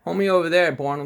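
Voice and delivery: sudden high, loud jeer at the man on screen; scornful call-out. Real, not acted. Vlogger jeering at the senator on TV, loud scornful call-out. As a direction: sneer it out.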